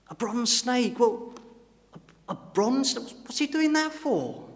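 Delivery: high, slow, baffled disbelief; rising, drawn-out astonishment; incredulous. Acted. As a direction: ask it as if the idea is absurd. Townsman baffled by the bronze snake.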